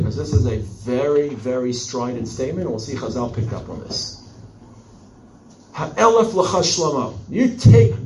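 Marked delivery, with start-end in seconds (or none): 5.7-7.9 raised, heated challenge; heated retort. Acted. The woman fires back at Shlomo, heated and raised. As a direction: fire the words back at him. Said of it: "This is people screaming at each other".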